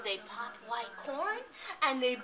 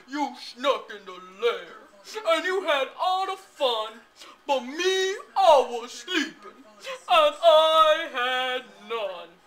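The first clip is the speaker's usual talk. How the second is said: bear voice; 10 dB louder than usual. Acted. Bear complains in story.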